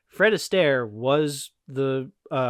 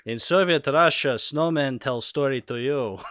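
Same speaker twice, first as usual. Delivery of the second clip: put-on Russian accent; 4 semitones higher, 4 dB louder than usual. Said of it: "I can't do a Russian accent".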